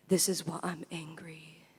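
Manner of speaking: low, near-silent whisper; whispered refrain. Acted. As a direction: breathe the line out in a whisper.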